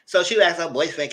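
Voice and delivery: usual speaking voice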